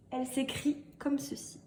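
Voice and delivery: usual speaking voice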